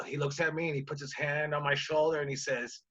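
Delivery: ordinary talk, nothing marked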